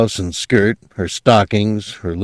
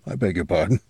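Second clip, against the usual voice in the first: soft, apologetic dog voice; 8 dB quieter, much faster than usual. A narrator's reading. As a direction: murmur the apology.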